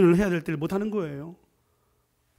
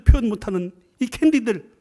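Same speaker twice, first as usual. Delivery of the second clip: ordinary talk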